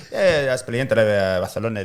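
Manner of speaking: hammed-up Spanish nonsense; fake Spanish gibberish. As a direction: bluff in mock Spanish. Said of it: "this conversation in Spanish".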